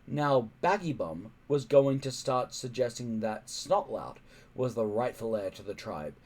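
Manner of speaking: ordinary delivery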